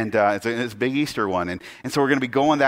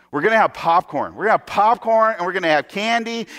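higher, rising pastor enthusiasm; excited gushing; 5 semitones higher, 4 dB louder than usual. Acted. Idiot pastor promises the kids popcorn and candy, excited gushing. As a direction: hype it up like an over-eager pastor.

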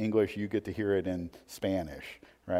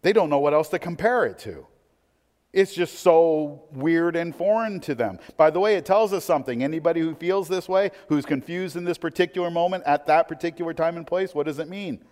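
higher-pitched, emphatic sermon delivery; impassioned preaching; 8 semitones higher, 6 dB louder than usual. Real, not acted. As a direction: press the point on the congregation with raised voice. Preaching at raised intensity.

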